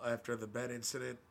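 usual speaking voice

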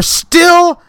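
high, loud exasperated outburst; exasperated rant. Real, not acted. Ranting to listeners about lyric-quoters, loud and exasperated. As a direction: rant it, voice climbing in disbelief. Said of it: "ranting at still doing it".

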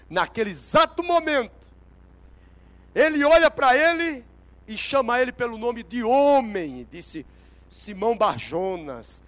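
loud, emphatic, drawn-out proclamation; preaching at full force. Real, not acted. Preacher retelling Jesus's reply to Simon, forceful preaching. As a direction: build the revelation as a mighty announcement.

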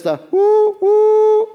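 high, loud imitated train whistle; a loud "woo woo" train whistle. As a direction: whistle it like the one o'clock train.

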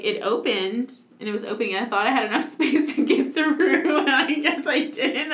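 ordinary talk

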